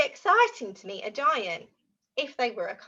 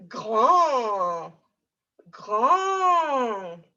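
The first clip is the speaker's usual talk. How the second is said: long, stretched, swelling word; big drawn-out vowel; acting out big; 5 dB louder than usual. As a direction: make the word sound huge.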